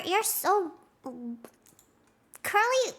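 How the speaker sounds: ordinary talk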